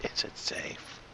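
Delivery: eerie teasing whisper. Pretend. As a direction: breathe the question, menacing. Teasing quote repeated as whisper.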